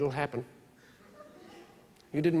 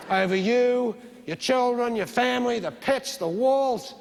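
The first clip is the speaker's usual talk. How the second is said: mock preaching build; 6 semitones higher than usual. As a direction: sell the apocalypse to the congregation. Building televangelist rant.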